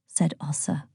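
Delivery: narration tag kept in a whisper. A narrator's reading. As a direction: stay in the hush for the tag.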